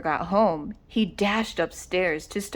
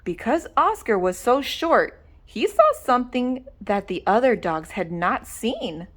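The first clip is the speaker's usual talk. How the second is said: plain reading voice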